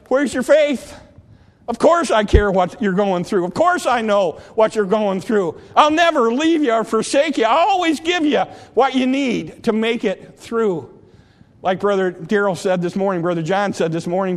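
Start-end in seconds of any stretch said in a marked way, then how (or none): 0.1-10.9 Jesus answering his frightened followers; reassuring preached Jesus. Acted. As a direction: promise it with full fervour.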